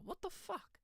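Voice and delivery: squeaky high startled what the fuck; shocked. Retold or imitated. Retelling his reaction to a mystery email, shocked squeak. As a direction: jump way up in pitch, caught off guard. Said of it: "I was like".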